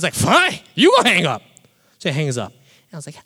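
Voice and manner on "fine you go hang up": loud, high-pitched angry comeback; heated retort. Retold or imitated. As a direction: throw it back at her, acting out indignation. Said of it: "I was so heated".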